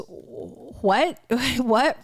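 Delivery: incredulous rising what, twice; disbelieving what. Retold or imitated. Viewer reacting to an odd accent on TV, incredulous. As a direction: hear it and can't believe it. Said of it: "And I was".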